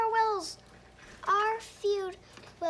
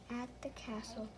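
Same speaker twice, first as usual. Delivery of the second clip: whispered; 9 semitones lower, 13 dB quieter, faster than usual. Real, not acted. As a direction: whisper it, barely any voice. Child trails into whisper.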